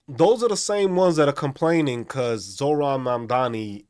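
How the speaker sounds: loud, pressing rant; loud exasperated ranting. Real, not acted. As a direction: push it out loud and fed up. Ranting at complainers.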